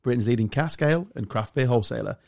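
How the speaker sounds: quick, even ad read; sponsor read. Acted. Host reading the sponsor message, as an ad. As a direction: read the sponsor plug smoothly.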